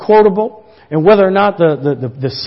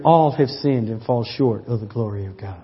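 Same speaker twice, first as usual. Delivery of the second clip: much softer and lower, hushed; soft whisper; 9 semitones lower, 10 dB quieter than usual. Retold or imitated. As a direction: breathe it gently into an ear. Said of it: "the Lord whispers in my ear".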